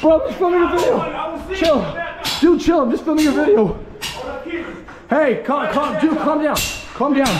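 high, loud urgent shouts; panicked pleading. Real, not acted. Filmer telling an armed man to calm down, panicked shouting. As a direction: yell to calm him down. Explaining himself to armed attacker.

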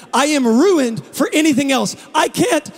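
Isaiah offering himself to God, rising to a shout; passionate surrender. Acted. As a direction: pour it out, climbing to a desperate cry of yes. Isaiah answers God, preacher climaxing.

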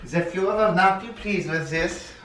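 put-on high-pitched German-accented officer; mock German officer. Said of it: "like some n-, officer, like, in the German military".

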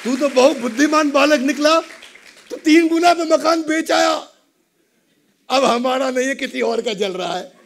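high, loud gleeful praise; father shouting happily at his son; overjoyed. Acted. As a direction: crow with delight at the clever son. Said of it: "बहुत खुश हुए".

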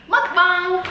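high, loud excited call; excited announcement. Real, not acted. Announcing the start of eating.

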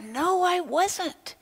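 high, slow, drawn-out denial; Peter denying. Acted. Peter, questioned a second time, denies it high and slow. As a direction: stretch out a nervous denial.

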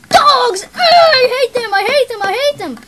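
very loud, high tantrum; hammed-up furious shouting. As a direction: scream your hatred like a raging villain. Villain rants about hated dogs.

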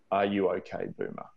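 ordinary talk

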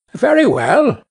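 lightly voiced sparrow, lifted in pitch; a hint of a chirpy bird; obliging sparrow.

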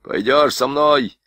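the beaten sergeant ordering his wife about; angry orders. A narrator's reading. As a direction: bark at the wife in wounded rage. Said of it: "приказал жене".

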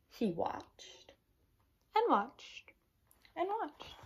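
soft, spaced-out repetition; hushed waiting. A narrator's reading. Narrator tells children of the pollywog's patient wait, hushed. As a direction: let each "watched" drop away quietly.

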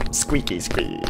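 usual speaking voice

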